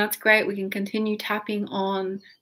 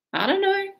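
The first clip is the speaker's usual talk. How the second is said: high, light I don't know with a shrug; shrugging; 10 semitones higher than usual. Pretend. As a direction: toss it off. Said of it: "And shrug your shoulders".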